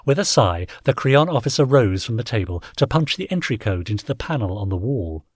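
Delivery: ordinary delivery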